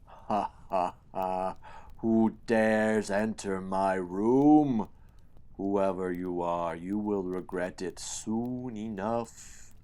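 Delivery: slow, booming monster voice; deep bellowing villain. A narrator's reading. Giant fungus boss threatens the intruder, deep and bellowing. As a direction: boom it out, drawn out and menacing. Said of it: "Malungus laughs a deep, bellowed laugh".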